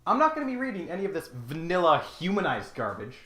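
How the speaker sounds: ordinary talk, nothing marked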